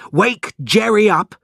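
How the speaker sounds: high, loud urgent order; urgent shout. A narrator's reading. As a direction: call out to rouse the household. Margot raising the fire alarm.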